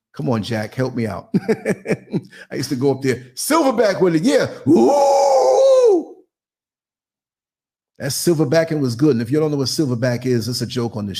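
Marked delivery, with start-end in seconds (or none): 4.7-6.2 high, loud mock climax yell; climax cry. Joking about the silverback move, a loud high mock cry. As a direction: belt out a hammy climax ooh.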